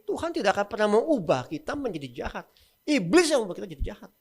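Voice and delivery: usual speaking voice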